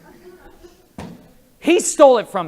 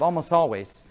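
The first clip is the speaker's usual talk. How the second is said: usual speaking voice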